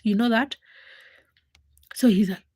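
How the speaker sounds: normal talking voice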